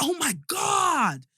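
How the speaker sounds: high, drawn-out mock shout; imitated losing his mind. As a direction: mimic someone overwhelmed with delight. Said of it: "Literally losing his mind".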